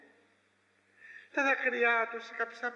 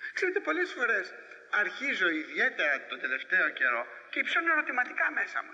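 ordinary talk

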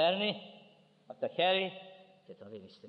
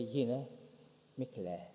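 tender consoling; 2 semitones lower, 6 dB quieter than usual. Acted. Christ gently consoling a mourning mother.

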